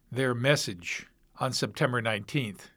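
ordinary talk, nothing marked

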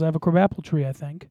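ordinary delivery

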